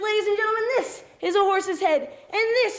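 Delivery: high-pitched ringmaster announcement; head clown announcing. Acted. Clown repeats his gag on Kenny, announcing high. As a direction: announce it grandly to the crowd. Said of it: "And he says".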